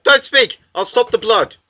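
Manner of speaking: high, loud panicked order; acted alarm, voice jumping up; urgent command. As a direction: call out in an emergency. Acting rescue of bleeding friend.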